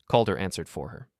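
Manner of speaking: ordinary delivery, nothing marked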